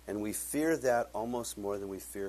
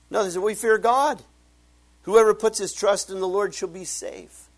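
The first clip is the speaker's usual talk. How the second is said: loud, high preaching at full force; fiery preaching; 9 semitones higher, 10 dB louder than usual. Acted. Preacher booming to the congregation, loud and high. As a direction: preach it out, voice raised and ringing.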